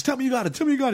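high urgent pleading; high mock-urgent plea in the elevator. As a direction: beg for good news. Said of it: "and he says".

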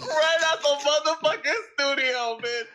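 high hyped shout; excited. Real, not acted. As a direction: hype it up high. Hyped prediction.

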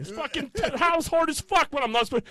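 high, loud hammed-up whine about sore knees; mock complaining yell.

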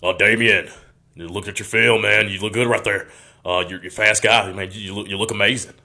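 hearty impression of a recruiting coach; Adam Hollifield. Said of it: "let me try to do the impersonation".

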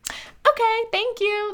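high, chirpy acted reply; mock sudden acceptance. Vlogger mimics giving in after a fit, mock high-pitched agreeableness. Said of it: "transition out of throwing a fit".

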